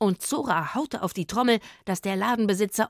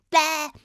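tongue-out bäh; 9 semitones higher, 6 dB louder than usual. Acted. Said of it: "streckte ... die Zunge heraus".

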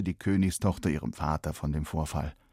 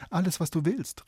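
eifriger Ruf; 10 semitones higher than usual. Acted. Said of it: "rief die Königstochter".

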